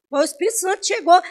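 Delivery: ordinary delivery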